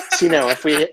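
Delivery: laughing high and loud. Real, not acted. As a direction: giggle through the words.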